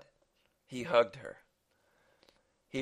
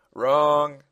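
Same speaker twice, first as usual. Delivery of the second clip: loud, raised, drawn-out correction; annoyed; 5 semitones higher, 10 dB louder than usual. Acted. Masked man correcting the father, loud and annoyed. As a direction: call it out with annoyance.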